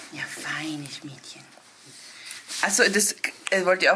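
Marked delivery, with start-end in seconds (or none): 0.1-1.4 whispered soft praise; whispering. Real, not acted.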